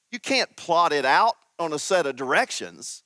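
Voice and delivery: normal talking voice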